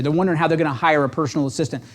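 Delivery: ordinary talk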